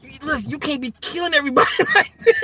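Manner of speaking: a hint of a scolding friend, laughing; mock warning through laughter; imitating Nick. Quoting Nick scolding him, half laughing. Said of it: "He be like".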